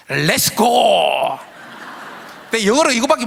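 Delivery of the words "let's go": coach's Let's go. Retold or imitated. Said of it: "어떤 말이냐면".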